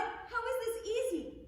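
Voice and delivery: loud, high-pitched exasperation; frustrated outburst. Acted. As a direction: whine loudly at the useless tool. Apprentice frustrated with sickle.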